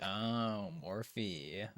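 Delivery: ordinary talk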